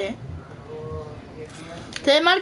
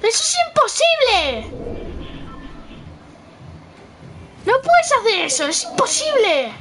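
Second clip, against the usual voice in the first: high, fast indignant shouting; outraged protest; 6 semitones higher than usual. Real, not acted. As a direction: refuse to accept it. (protesta indignada)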